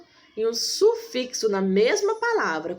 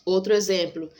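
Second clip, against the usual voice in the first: normal talking voice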